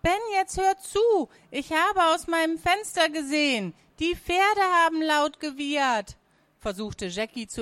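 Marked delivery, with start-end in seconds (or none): 0.0-6.2 put-on high-pitched, agitated girl; urgent, high young voice; insistent little sister. A narrator's reading. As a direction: plead to be believed in a high child's voice.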